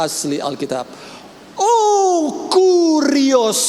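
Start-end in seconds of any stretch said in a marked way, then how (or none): 1.5-3.6 high, loud cry of Kurios; exclaiming Kurios. Acted. Preacher to congregation, crying out Kurios high. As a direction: cry out the name in awe.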